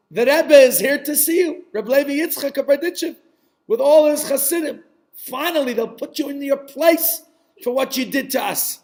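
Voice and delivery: loud, high telling-off; scolding wife. Acted. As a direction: lay into your husband, high and forceful. Wife scolds her drunken husband.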